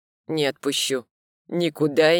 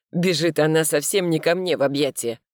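ordinary delivery, nothing marked

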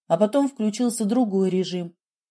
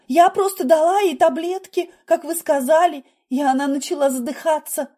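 high, loud, tearful-sounding protest; sobbing self-defence; 8 semitones higher, 4 dB louder than usual. A narrator's reading. Nurse explains the tablets to surgeon, sobbing panic. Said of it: "всхлипнула медсестра".

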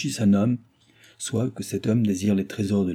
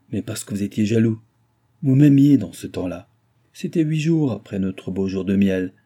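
put-on higher, louder jealous servant girl; sarcastic young maid; 5 semitones higher, 5 dB louder than usual. A narrator's reading. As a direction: bite back with scorn. Nicole defiant, jealous.

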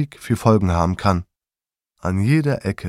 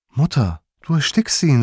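calling out; 6 semitones higher than usual. A narrator's reading. Son warns mother she is choking father, urgent call. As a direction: warn her urgently. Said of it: "rief ich".

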